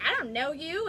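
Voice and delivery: mock the neighbor's sarcastic retort. Upset neighbor snubbing her in Costco, sarcastic. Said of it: "it was like this sarcastic".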